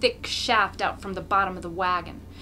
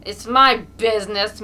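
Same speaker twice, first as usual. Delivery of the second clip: loud, defiant shout; angry shout; 11 dB louder than usual. A narrator's reading. Mokolka shouting at the accusing crowd, angry. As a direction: bark it back. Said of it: "Mokolka shouts".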